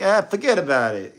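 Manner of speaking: thick New York "forget about it", high and punchy; New York accent. Shown as a demonstration. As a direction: throw it off like a Brooklyn guy. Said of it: "if I get angry, it comes out a lot more. I'm like".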